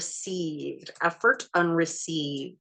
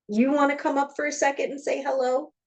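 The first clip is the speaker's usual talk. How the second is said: sweet pet voice; 9 semitones higher, faster than usual. Real, not acted. Inviting the cat up.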